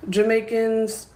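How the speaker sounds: ordinary delivery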